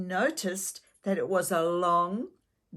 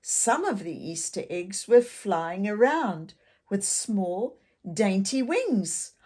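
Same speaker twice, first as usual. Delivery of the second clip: ordinary delivery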